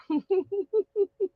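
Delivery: high soft giggle; quick soft laugh; light laugh. Real, not acted. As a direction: giggle between greetings. Host laughing.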